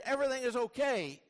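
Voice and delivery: high, emphatic declaration; upbeat declaration. Acted. Preacher tempted to just say all is fine, high declaration. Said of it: "for me to just say".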